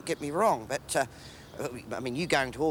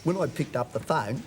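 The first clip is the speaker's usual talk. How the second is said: ordinary delivery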